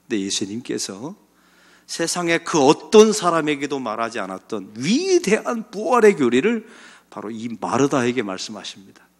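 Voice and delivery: ordinary talk, nothing marked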